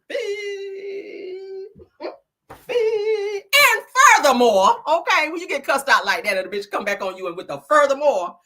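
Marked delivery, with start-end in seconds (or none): 0.1-3.4 very high, drawn-out beep; imitated scooter beep. 3.4-4.8 slow, high, loud declaration; mock indignant shout. Acts out resuming the cussing-out.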